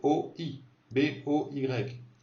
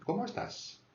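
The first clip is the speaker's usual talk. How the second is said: Comment high and softer; higher-pitched accent; 11 semitones higher, 5 dB quieter than usual. Shown as a demonstration. Teacher repeating the question, Comment softly pitched high. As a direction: lightly lift Comment into the highs. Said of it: "C'est un peu plus aigu".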